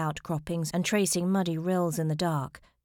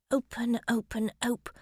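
urgent repeated plea, cut off mid-word; higher, insistent murmur to the door; willing it; 6 semitones higher than usual. A narrator's reading. Minerva wills the door to open, pleading. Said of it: "she willed".

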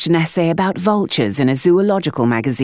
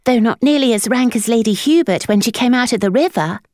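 put-on much higher child's voice; blunt boy; 9 semitones higher than usual. A narrator's reading. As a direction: flatly declare it. Boy elaborating.

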